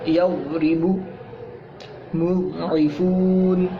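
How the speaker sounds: मुइफून खींचकर धीमे; Quranic example word. Shown as a demonstration. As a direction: stretch मुइफून slowly and deliberately. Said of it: "जैसे". (क़ुरानी लफ़्ज़ धीमे धीमे अदा)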